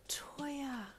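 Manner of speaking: breathless whispered gasp of a name; shocked gasped whisper. A narrator's reading. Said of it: "Ray gasped".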